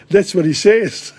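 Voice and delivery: chuckling through the line; laughing. Real, not acted. Teacher laughing while translating for the class.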